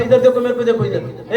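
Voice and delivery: ordinary delivery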